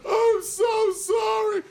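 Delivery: very high, slow wailing sob; hammed-up crying; sobbing apology. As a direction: wail the apology through tears. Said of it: "He's like".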